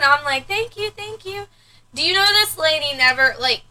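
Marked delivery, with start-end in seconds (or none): 0.5-1.5 higher, softer polite thanks; polite mock reply. Mother imitating her own weary polite reply, higher and soft. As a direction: mouth a dutiful thank you.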